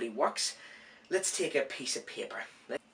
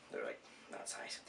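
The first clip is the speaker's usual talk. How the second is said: whispering; 13 semitones lower, 14 dB quieter than usual. Real, not acted. Whispered aside.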